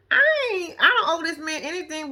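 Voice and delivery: sudden high yelp of disbelief; indignant outburst. Real, not acted. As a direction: jump up in pitch, offended.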